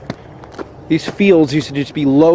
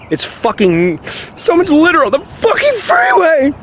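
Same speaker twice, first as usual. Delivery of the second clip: exasperated outburst; 13 semitones higher than usual. Real, not acted. Ranting at the destroyed forest.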